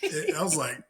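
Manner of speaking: high, baffled mock all right; stunned put-on voice; imitating his own confused reply; confused agreement. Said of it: "I'm like".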